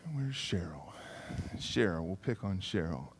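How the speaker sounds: hushed whisper to himself. Real, not acted. Preacher searching the congregation for Cheryl, whispering. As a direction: breathe it without voice, searching the room.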